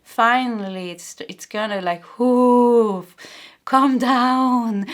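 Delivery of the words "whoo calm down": whoo release. Real, not acted. Releasing heavy energy, exhaling.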